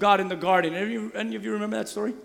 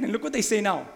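ordinary talk

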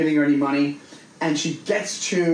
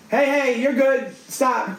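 raised, high calls to back off; firm call out; 8 semitones higher, slower than usual. Retold or imitated. Telling the woman on the train to stop, raised firm voice. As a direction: tell her to cut it out, firmly.

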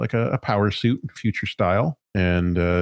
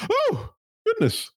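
high character voice. Acted. GM voices robot receptionist.

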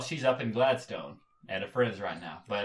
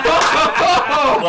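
loud high laughter through words; laughing; 10 dB louder, much slower than usual. Real, not acted. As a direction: burst out laughing mid-read. Cracking up reading his reply.

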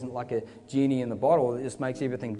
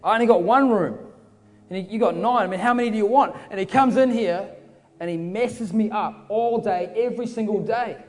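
exasperated complaint; 5 semitones higher, 4 dB louder than usual. Acted. Fed-up man complains to Jesus about the intruder, exasperated. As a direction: protest with mounting frustration.

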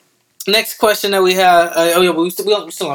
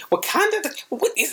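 much higher, puzzled mock question; imitated surprise; 10 semitones higher, 5 dB quieter than usual. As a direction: mimic her baffled first reaction. Mimics her first hearing Wakanda.

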